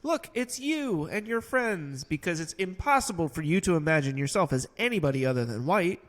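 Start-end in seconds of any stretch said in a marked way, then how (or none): 0.0-2.0 ad voice. Retold or imitated. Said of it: "they would just say".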